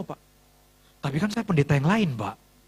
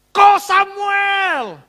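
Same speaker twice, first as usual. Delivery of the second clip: high, loud, long-held call; answering shout; 13 semitones higher, 8 dB louder, much slower than usual. Retold or imitated. Pastor recognising old friend across airport, joyful shout. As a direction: holler the name back, stretched out. Calls back to friend far off.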